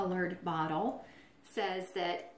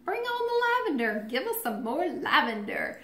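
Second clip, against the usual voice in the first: high, loud, squealed delight; sing-song excited high voice; giddy mock cheer; 5 dB louder than usual. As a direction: squeal it like overjoyed parents. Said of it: "so we were like".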